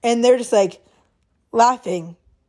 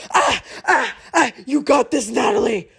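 mock workout yell. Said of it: "I scream when I work out".

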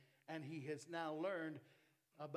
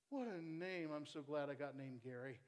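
ordinary talk, nothing marked

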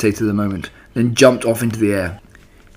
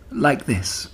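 breathy whispered aside; 3 semitones higher, 5 dB quieter than usual. Acted. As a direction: show him in a whisper. Charlotte demonstrating to Wilbur.

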